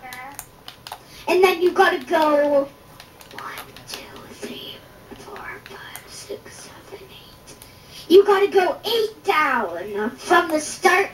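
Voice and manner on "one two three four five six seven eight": barely audible, whispered count; counting under breath. Real, not acted. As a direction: whisper the numbers to yourself. Counting menu items under the breath.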